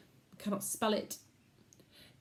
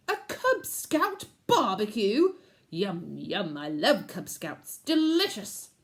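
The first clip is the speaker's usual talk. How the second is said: greedy wolf uncle; 5 dB louder, slower than usual. A narrator's reading. Uncle gloating about eating Cub Scouts, greedy. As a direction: savour every word like food.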